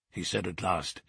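ordinary delivery

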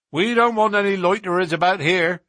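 loud, high-pitched warning; gruff stable lad's challenge; unwelcoming groom. A narrator's reading. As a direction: shoo strangers away loudly. Groom warns off strangers.

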